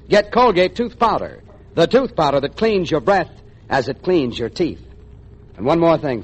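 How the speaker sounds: usual speaking voice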